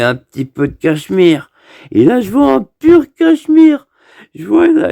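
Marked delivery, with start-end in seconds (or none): 1.9-4.9 excited discovery. Retold or imitated. Sees the pure cashmere.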